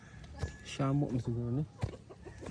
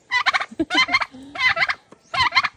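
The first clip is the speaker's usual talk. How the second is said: high squealing laugh; 11 dB louder than usual. Acted. Laugh in a put-on voice.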